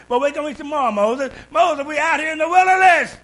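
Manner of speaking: hammed-up grumbling Israelites; mock whining crowd. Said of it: "They complained, they hollered, they cried".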